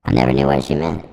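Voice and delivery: ordinary talk, nothing marked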